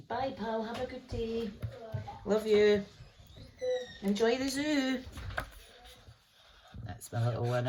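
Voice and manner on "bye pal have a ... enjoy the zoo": sing-song, much higher, tender; high sweet goodbye. Real, not acted. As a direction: coo it fondly. Saying bye to child.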